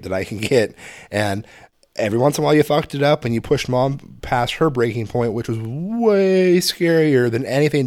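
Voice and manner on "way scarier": WAY stretched long and pitched up; drawn-out WAY. Real, not acted. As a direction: lean hard on "way", dragging it out. Stressing how scary mom was.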